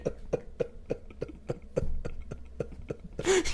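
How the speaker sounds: breathless high-pitched laugh; cracking up at the story. Real, not acted. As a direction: let the laugh run high and airless.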